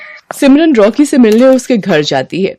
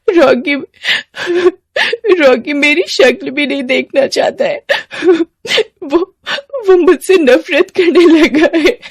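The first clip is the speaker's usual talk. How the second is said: high sobbing, broken by gasps; crying; 9 semitones higher than usual. Acted. Rejected girl telling her friend he hates her, crying. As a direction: sob it out to a friend. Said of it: "सिमरन रोते हुए मीनल के पास जाती है".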